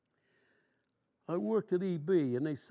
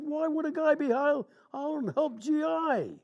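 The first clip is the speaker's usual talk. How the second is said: much higher, louder puzzled ask; incredulous raised voice; baffled question; 9 semitones higher, 5 dB louder than usual. Retold or imitated. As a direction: ask in disbelief.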